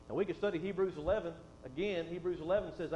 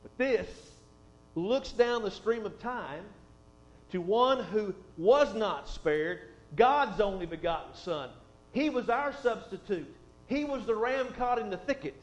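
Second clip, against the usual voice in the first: slow, louder, weighty preaching; preaching emphasis; 5 dB louder, slower than usual. Acted. Preacher points the congregation to the Son, slow fervent preaching. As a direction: lean into each phrase to drive it home.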